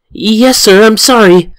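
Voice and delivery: high-pitched, meek apology to teacher; acted high student voice; apologetic student.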